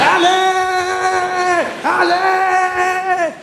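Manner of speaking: high, loud, long-held cry; thrilled, drawn-out holler; excited yell. Acted. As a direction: whoop it out in elation, stretching the end. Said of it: "I was so excited".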